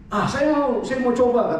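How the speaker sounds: put-on high, eager child voice; insistent child. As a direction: play a kid insisting on a turn.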